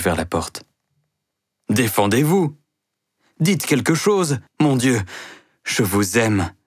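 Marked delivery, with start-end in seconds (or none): 1.7-5.0 desperate plea. Acted. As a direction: push it up, pleading and frantic. Mouret pleads with Denise.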